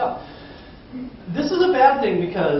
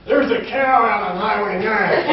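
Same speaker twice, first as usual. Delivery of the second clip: put-on southern accent; 5 semitones higher, 6 dB louder, slower than usual. Rural caller reporting a cow to 911, southern accent. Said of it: "North Idaho has a southern accent".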